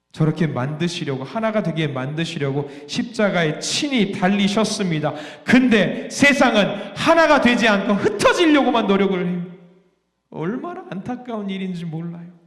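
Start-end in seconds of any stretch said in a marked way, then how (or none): none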